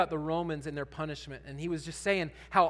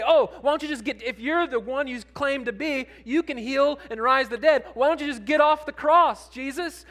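mocking; 6 semitones higher, 6 dB louder than usual. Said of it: "They're gonna take nails and say".

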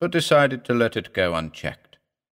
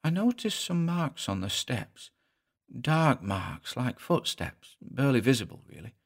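soft, higher-pitched little-man voice; David, reluctant little husband; 3 semitones higher, 6 dB quieter than usual. Acted. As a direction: recount it meekly with a regional accent.

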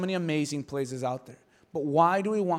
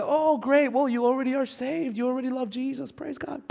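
high, put-on pleased voice; mock gushing believer; 6 semitones higher than usual. Mimics an easily satisfied churchgoer.

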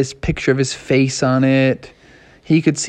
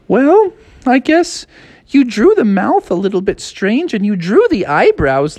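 sister voice; 8 semitones higher than usual. Acted. Sister musing on the ornament in a high voice. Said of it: "And she's like".

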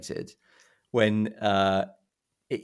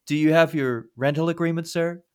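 cheery clerk; 8 semitones higher, 4 dB louder than usual. Retold or imitated.

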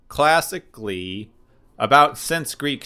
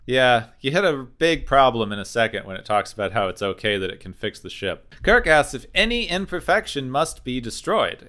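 normal talking voice

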